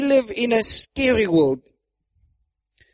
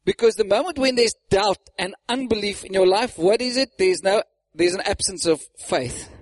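usual speaking voice

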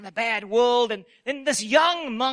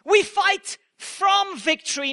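usual speaking voice

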